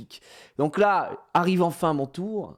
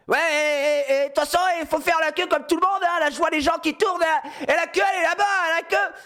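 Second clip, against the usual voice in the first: mock angry yelling; 11 semitones higher than usual. Bald man yelling at people about the queue, mock angry rant. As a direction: whine it out like an outraged line-jumper hater. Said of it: "le mec qui gueule".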